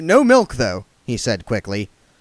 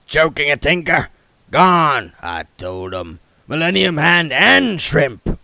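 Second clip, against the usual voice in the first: put-on mad old man shout; 5 semitones higher, 7 dB louder than usual. A narrator's reading. Foul old Ron ranting.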